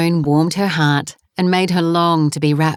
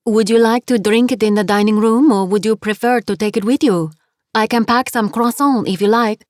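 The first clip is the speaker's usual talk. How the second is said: higher French landlady, kindly offering; French accent; 5 semitones higher than usual. Acted. Landlady offering coffee to go, French accent. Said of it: "her accent was heavy".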